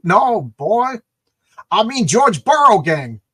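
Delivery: acted exasperated correction; emphatic correction. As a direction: correct him forcefully, stretching the words. Acting a character's emphatic correction.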